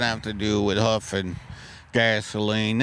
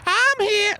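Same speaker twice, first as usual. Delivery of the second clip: very high squeak of a separate person; squeaky other voice. Acted. One guy faking a second bar patron, very high and squeaky. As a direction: pop in as a tiny hidden bar guest. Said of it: "That's me again".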